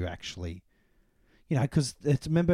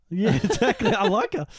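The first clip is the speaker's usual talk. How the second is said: chuckling, higher and louder; laughing; 11 semitones higher, 6 dB louder than usual. Real, not acted. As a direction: laugh out the agreement. Laughs agreeing with the quote.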